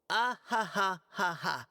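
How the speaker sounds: a hearty laugh read off the page, lightly acted; reading out his laugh. [name] reading the Grand Master's laugh aloud, mild mock hearty. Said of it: "His laugh, not mine".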